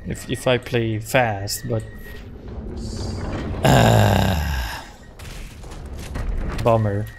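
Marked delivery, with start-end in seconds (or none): none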